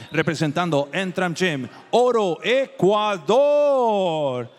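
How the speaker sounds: long, drawn-out, shouted fighter name; lucha libre announcer. Real, not acted. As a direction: belt the name out to the crowd. Ring announcer calling a fighter's name.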